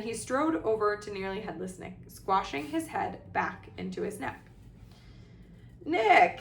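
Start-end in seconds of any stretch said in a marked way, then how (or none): 5.8-6.4 high, loud hearty roar. A narrator's reading. Headless ghost hailing his old acquaintance, roaring. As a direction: boom out the name in greeting. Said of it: "he roared".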